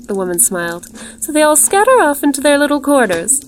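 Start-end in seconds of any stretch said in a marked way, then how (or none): 1.2-3.4 smiling hostess. A narrator's reading. Strange woman explaining her shy rabbits to a visitor, smiling. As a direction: chatter fondly in a high voice.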